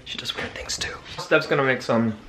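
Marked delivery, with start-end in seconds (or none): 0.0-0.9 whisper. Real, not acted. Secret aside about wife.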